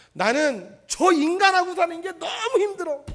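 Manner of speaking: loud, high complaint about a spouse; exasperated complaint. Retold or imitated. Preacher voicing a spouse fed up with their partner. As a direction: vent it up high. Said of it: "힘이 든 사람은요".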